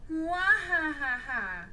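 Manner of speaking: slow villain laugh, mwahahaha; evil cackle. Acted. Evil father cackles at his son, slow villain laugh.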